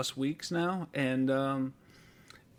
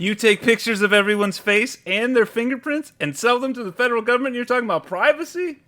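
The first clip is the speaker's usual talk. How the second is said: loud, high, building rant; indignant disbelief; 6 semitones higher, 8 dB louder than usual. Retold or imitated. Customer argues with the manager over privacy, indignant. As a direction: throw the accusation back, incredulous. Retold argument with store manager.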